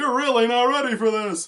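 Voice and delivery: high, loud outraged shout; frantic protest. Retold or imitated. Protesting a fourteen-year-old's marriage in the show, frantic high shout. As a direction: yell it in shrill, over-the-top alarm.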